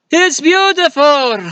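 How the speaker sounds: high, slow, awestruck exclamation; awed cry. Retold or imitated. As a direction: marvel at it in a high drawn-out voice. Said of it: "Belloq was going like".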